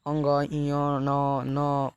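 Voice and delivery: "अंग, इय, न, न" sounded out one by one as nasal letters; nasal sounds demo. Shown as a demonstration. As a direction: hum out each nasal "अंग, इय, न, न" separately. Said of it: "जो लास्ट वाला होता है ना".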